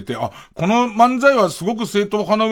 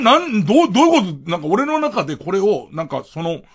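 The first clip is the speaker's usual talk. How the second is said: normal talking voice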